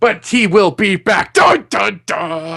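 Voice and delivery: slowed, hammed-up cliffhanger with a dun dun dun; mock ominous teaser. Story closing on the villain's return, mock-dramatic. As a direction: play the sequel sting, lightly.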